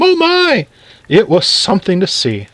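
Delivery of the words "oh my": very high, mock-terrified shriek; yelled alarm. A narrator's reading. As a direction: imitate a woman shrieking at a mouse. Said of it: "yelled Missus Newcomb".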